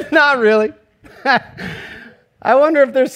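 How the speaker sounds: laughing through the words; real laugh at his own story. Real, not acted. Pastor laughs telling the congregation about the boy.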